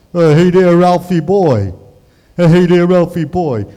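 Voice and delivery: loud, dopey pal's greeting; goofy sitcom sidekick. Acted. Neighbor greeting his pal Ralphie, loud sidekick impression. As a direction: holler a big dim-witted hello. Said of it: "Ralph Cramden impression".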